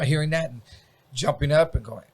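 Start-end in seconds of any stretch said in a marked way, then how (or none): none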